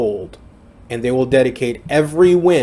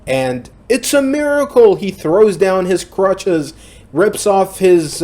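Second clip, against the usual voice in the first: higher and louder, thrilled retelling; excited; 6 semitones higher, 4 dB louder than usual. Real, not acted. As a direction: sell the miracle like a hype man. Narrating comeback miracle twist.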